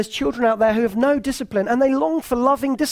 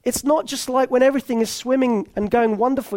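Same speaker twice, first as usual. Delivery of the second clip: normal talking voice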